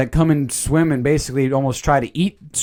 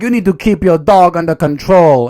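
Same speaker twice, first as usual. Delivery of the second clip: Miss Lenoir; 2 semitones higher, 5 dB louder than usual. Acted. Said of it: "Miss Lenoir is like".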